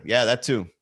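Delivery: usual speaking voice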